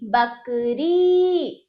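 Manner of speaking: बकरी very slow, the final री held long; drawn-out vowel. Shown as a demonstration. Teacher models the word for pupils, drawn out. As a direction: stretch the ई ending of बकरी. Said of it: "खींच रही हूँ".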